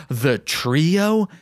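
steady read-aloud narration; calm fanfic reading; story narration. Acted. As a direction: read the story evenly, like an audiobook.

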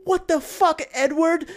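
high-pitched indignant outburst; outraged wife. Acted. Wife confronting husband over the documentary, mock outrage.

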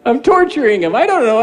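a frazzled grandpa answering his wife on the phone; flustered reply. Retold or imitated. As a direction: blurt it out, frazzled.

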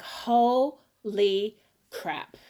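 very slow, drawn-out amazement; awestruck. Retold or imitated. Singer reliving her moment on stage, awestruck. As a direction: stretch each word out in wonder.

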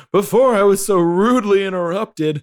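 a hammed-up heel promo line; wrestler's mic taunt. Host quoting CM Punk on the mic, hammed-up taunt. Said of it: "And he's like".

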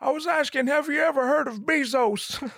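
put-on high, thin old man voice, then a shout; hammed-up high old man shouting. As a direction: squeak it out like a frail old guy.

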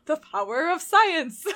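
slow, loud, high heroic proclamation; Winston from Overwatch. Acted. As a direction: belt out a game hero's catchphrase. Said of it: "like, the way Winston would go".

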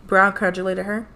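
plain reading voice

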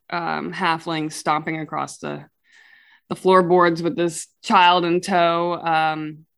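ordinary talk, nothing marked

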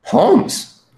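high, astonished cry of the name; astonished exclamation. Acted. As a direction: gasp out the name in amazement. Watson astonished at deduction. (exclamação espantada, bem aguda)

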